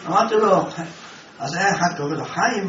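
ordinary talk

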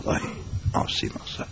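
unvoiced whisper of dismay; whispering to himself after the candle goes out. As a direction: breathe it out, at a loss. Candle blown out.

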